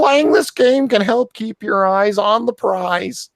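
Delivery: high-pitched put-on activist voice; mock an earnest larper in a squeaky high pitch; neat larper voice. Host mocking an article he can't read normally, high larper voice. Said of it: "the neat larper voice".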